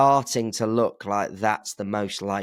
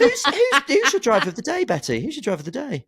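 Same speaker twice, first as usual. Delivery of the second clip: laughing question; 5 dB louder than usual. Real, not acted. Laughing while hosting.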